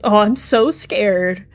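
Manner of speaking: mock fear in a lilting voice; sing-song mocking. A narrator's reading. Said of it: "he murped in a sing-song voice".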